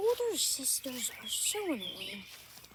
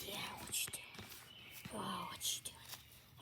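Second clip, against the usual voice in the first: whisper; 6 semitones lower, 11 dB quieter than usual. Real, not acted. Whispering to animal playfully.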